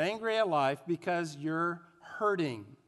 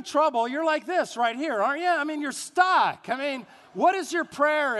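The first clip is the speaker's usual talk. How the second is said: emphatic preaching; 6 semitones higher, 4 dB louder than usual. Real, not acted. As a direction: press the point hard. Preacher at full force.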